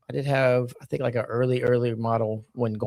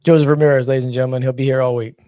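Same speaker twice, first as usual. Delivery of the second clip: loud comedy-club emcee sign-off; mock announcer; 10 dB louder, faster than usual. Co-host ribbing the host's joke, mock announcer. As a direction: announce the comic to the room, big and loud.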